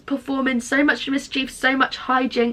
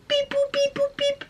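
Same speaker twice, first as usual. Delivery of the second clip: high squeaky beep boop dialing; phone dial beeps; 4 dB quieter than usual. Retold or imitated. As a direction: imitate dialing a phone number. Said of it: "this will be me".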